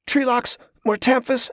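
high, strained and out of breath; a struggling boy gasps out the answer; panting boy. A narrator's reading. Boy panting while sparring.

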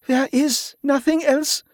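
high, slow anguished moan; voice cracking. A narrator's reading. Wife told her husband is beyond help, high cracking moan. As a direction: moan it high, near breaking. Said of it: "Yvette moaned, her voice cracking".

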